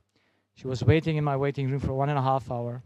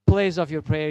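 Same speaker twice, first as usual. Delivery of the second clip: ordinary delivery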